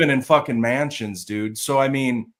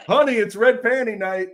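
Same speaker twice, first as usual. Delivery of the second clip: mock wife voice; 9 semitones higher than usual.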